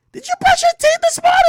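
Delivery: high, loud nagging mom voice; mom's question. Retold or imitated. Mom asks her son about his breath, high loud nag. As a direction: nag like a mother. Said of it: "She's like".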